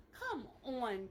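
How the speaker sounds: normal talking voice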